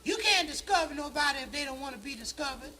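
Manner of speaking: high put-on defiant woman; sassy girl voice. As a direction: tell him off with attitude. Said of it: "Little Indian girl said".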